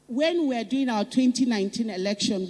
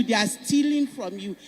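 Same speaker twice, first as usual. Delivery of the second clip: usual speaking voice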